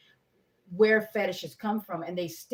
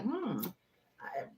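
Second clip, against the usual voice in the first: soft pondering; 5 dB quieter than usual. Retold or imitated. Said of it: "because I'm like".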